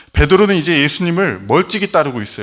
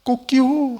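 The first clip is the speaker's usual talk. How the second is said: high mock rooster crow; 10 semitones higher, much slower than usual. Pastor imitating the rooster crowing at dawn, high mock crow. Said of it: "닭이".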